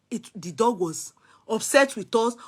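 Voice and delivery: ordinary talk, nothing marked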